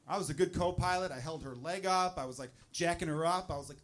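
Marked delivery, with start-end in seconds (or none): none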